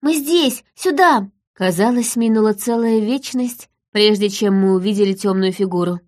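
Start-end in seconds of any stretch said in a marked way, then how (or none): 0.0-1.3 high, excited calls; child hollering. A narrator's reading. Daughter calling her father toward them, high hollering. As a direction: shout high to guide someone over. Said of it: "заголосила она".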